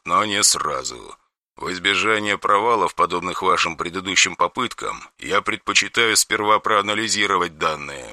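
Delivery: soft, emotionless monotone; deadpan meme voice. Acted. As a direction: lecture him without any feeling. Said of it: "безэмоционально ответили ему".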